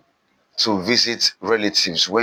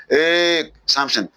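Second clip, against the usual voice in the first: calling out; 6 semitones higher, slower than usual. Acted. Commander checks his gunman in story.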